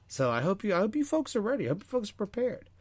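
usual speaking voice

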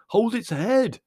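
high, loud frantic order; urgent shouting. A narrator's reading. Leslie struggling with the donkey, shouting urgently. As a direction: yell for help mid-struggle.

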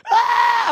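put-on high piercing child's cry "Ah!"; imitating a child's shriek; child's wail. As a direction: squeal like a scared little boy. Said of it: "He's like".

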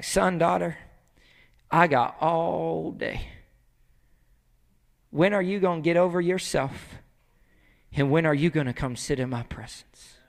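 low, soft, unhurried appeal; patient God. Acted. God to his wayward children, soft patient appeal. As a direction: wait them out tenderly.

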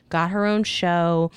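usual speaking voice

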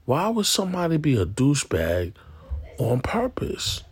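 can't believe anyone would do that; baffled disbelief. Retold or imitated. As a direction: disbelief at a friend's deliberate trolling. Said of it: "and I'm just like".

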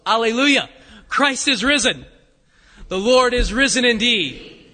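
loud, ringing Easter acclamation; forceful, declaimed liturgical cry; joyful proclamation. Real, not acted. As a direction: proclaim it out to the whole congregation.

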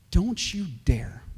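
slow, hushed threat; whispered warning. Acted. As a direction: whisper it slowly as a stern warning. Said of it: "And John said".

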